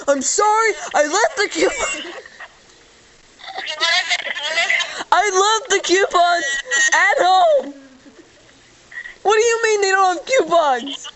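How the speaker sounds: a customer near tears over Big Macs; getting upset. Acted. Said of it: "I'm getting a little upset".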